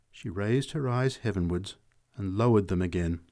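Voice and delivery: usual speaking voice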